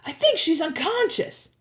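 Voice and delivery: high calling voice; calling from above. A narrator's reading. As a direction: report hopefully to friends below. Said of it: "Grover yelled".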